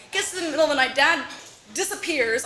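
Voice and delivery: ordinary delivery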